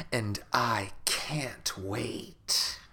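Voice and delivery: slow sinister whisper; slow creepy whisper to end the book. Acted. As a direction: breathe the last line out slowly. Sinister last line of book.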